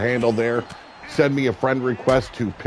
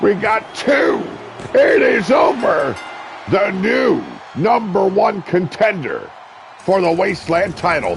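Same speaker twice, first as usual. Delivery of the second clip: triumphant shout; 7 semitones higher than usual. Real, not acted. Commentator announcing the new contender, triumphant shout. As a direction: announce the winner with full force. Winning pin called.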